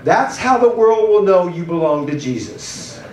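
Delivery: usual speaking voice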